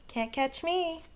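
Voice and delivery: a cheeky little monkey daring the crocodile; teasing monkey. Acted. Monkey taunts crocodile, high cheeky taunt.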